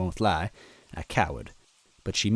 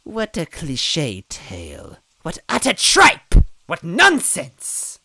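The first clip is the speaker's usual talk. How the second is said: building to loud high scorn; scornful outburst; 11 semitones higher, 7 dB louder, slower than usual. A narrator's reading. Villain scowling at their tale, loud scornful outburst. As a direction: spit the insults.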